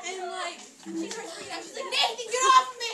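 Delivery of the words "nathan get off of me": high, very loud yell; acted freak-out. Drunk girl freaking out at a boy on top of her, shouted. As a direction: yell it to shove someone off.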